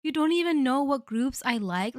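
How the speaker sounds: an exaggerated wounded snap at the partner; indignant retort. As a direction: fire it back, offended. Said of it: "And I'll be like".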